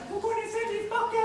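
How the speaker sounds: high-pitched mock woman's voice; feminine voice. Magician imitating a woman who comes to question him, mock feminine voice. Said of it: "j'essaie de prendre une voix un peu féminine".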